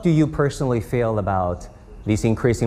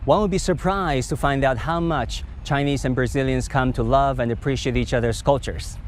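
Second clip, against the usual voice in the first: polished, slightly higher voice-over read; news read; 4 semitones higher than usual. Acted. TV host wraps up the Lula interview episode, polished anchor read. Host's closing voice-over and sign-off.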